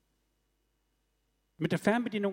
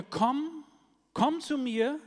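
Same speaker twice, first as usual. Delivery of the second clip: inviting call; 8 semitones higher, 3 dB louder, much slower than usual. Acted. Jesus calls people to him.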